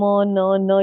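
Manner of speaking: ordinary talk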